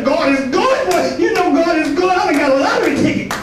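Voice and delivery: high-pitched mock jubilation; hammed-up gleeful boasting; elated lottery winner. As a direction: crow about your big win, pitch climbing. Mimics a jubilant lottery winner praising God.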